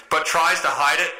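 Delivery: ordinary talk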